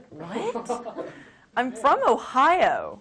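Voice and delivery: slow, laughing protest; amused real laugh; laughing disbelief. Real, not acted. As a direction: laugh through the words. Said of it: "laughing in disbelief".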